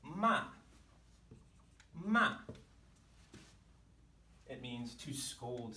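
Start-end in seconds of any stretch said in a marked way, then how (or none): 0.0-0.4 "ma" starts high and falls sharply; falling tone. Shown as a demonstration. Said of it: "pitch of my voice falls". 1.9-2.4 "ma" high and louder, falling quickly. Shown as a demonstration. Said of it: "pitch of my voice falls".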